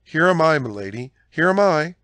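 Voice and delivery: high, loud eager call; cried out. A narrator's reading. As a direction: call out hurriedly to the mistress. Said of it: "cried Kitty, springing forward".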